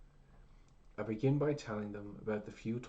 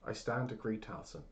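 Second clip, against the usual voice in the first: usual speaking voice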